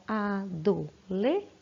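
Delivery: Adoletar said slowly, syllable by syllable: a-do-le-tar; slow syllable-by-syllable count for children; split into syllables. Shown as a demonstration. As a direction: clap out each syllable of Adoletar. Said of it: "Quatro pedacinhos, quatro sílabas".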